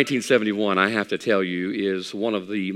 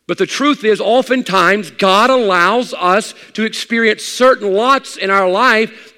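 high, loud pulpit delivery; full-force preaching; 10 semitones higher, 8 dB louder than usual. Acted. Preacher warning believers about the urge to complain, full-force preaching. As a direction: drive each point home to the congregation.

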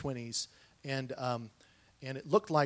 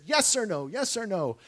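angry yelling; 6 semitones higher, 4 dB louder than usual. Retold or imitated. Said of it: "yelling at me at the top of her lungs".

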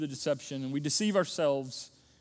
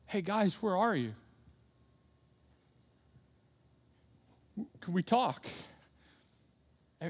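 gentle, hesitant, softly spoken invitation; loving heavenly Father; 2 semitones higher, 4 dB quieter than usual. Acted. God inviting Adam and Eve to talk, gentle and compassionate. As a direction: feel your way kindly into a hard talk. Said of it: "the voice of a loving Heavenly Father, full of grace and compassion".

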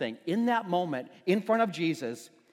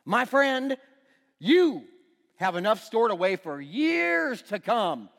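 louder, higher self-satisfied boast; smug rich man; 4 semitones higher, 6 dB louder, slower than usual. Retold or imitated. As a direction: pat yourself on the back, smugly. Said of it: "I'll say to myself".

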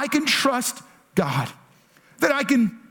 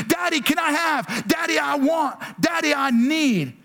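put-on higher, childlike pleading voice; eager kid asking; 6 semitones higher than usual. Kids running up to their father, eager childlike asks. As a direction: play the little kid begging dad.

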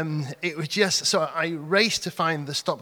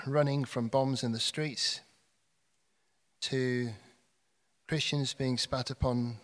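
prayer; 5 semitones lower, 5 dB quieter than usual. Real, not acted. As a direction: pray quietly for peace. Leads congregation in prayer.

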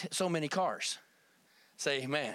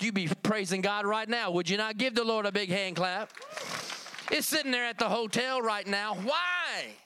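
raised, emphatic preacher's delivery; fervent preaching; 4 semitones higher than usual. Real, not acted. Preacher telling congregation God rewards givers, fervent and raised. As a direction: preach it out in driving, higher-pitched phrases. Testimony rising into full preaching.

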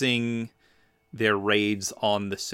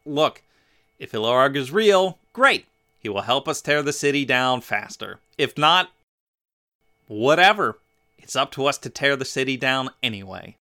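loud, higher-pitched, emphatic imitation; voicing someone who couldn't care less; brash, dismissive Domri; 4 semitones higher, 6 dB louder than usual. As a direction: play a cocky, shrugging character, punching key words. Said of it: "Domri's kinda like".

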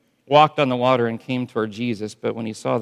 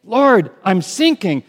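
high, loud desperate plea; pleading cry; 7 semitones higher, 6 dB louder than usual. Pretend. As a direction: cry out like you're going under. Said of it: "And when you say".

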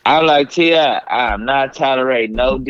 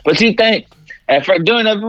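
ordinary delivery

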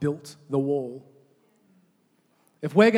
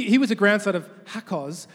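ordinary talk

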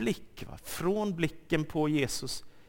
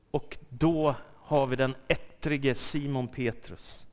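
ordinary talk

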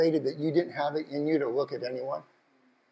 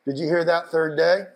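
normal talking voice

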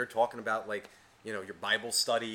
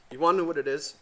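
ordinary talk

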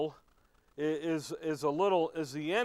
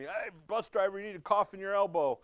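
put-on higher, quick little kid voice; 5 semitones higher, 4 dB louder, faster than usual. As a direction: play a bossy child, fast. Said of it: "I had a kid one time tell me".

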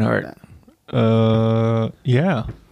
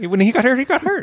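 high, climbing emphasis on got; rising, insistent explanation; 12 semitones higher, faster than usual. Shown as a demonstration. Explaining Harry Potter's shared pain to friends, insistently. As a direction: push each got higher, pleading to be understood. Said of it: "All right?".

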